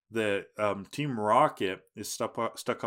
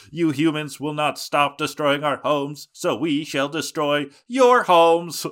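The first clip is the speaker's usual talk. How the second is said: louder, higher alien proclamation; 5 semitones higher, 8 dB louder than usual. Retold or imitated. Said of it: "he's got this, like, alien voice".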